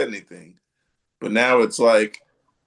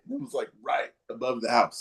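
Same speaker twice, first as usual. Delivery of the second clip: normal talking voice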